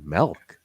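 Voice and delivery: "milk" with the vowel of "bell"; melk for milk. Retold or imitated. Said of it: "They pronounce it like M E L K".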